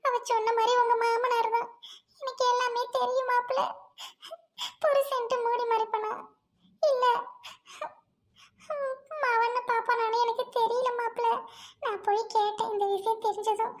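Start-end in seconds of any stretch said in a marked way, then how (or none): none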